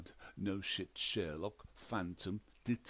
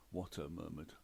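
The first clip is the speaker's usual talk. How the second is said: ordinary delivery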